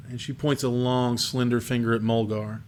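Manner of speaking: ordinary talk, nothing marked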